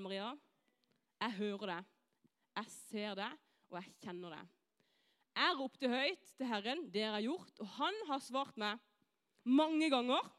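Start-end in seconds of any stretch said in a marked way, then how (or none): 0.0-4.6 low, soft, unhurried divine voice; gently reassure, pausing between phrases; tender reassurance. Acted. God leaning down to answer Maria, tender.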